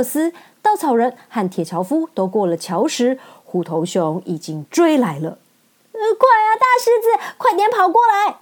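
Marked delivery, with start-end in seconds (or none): 5.9-8.4 much higher, louder urgent call. Acted. Friends yell to the lion to hurry, much higher and louder. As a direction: yell across the gorge. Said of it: "calling lion across bridge".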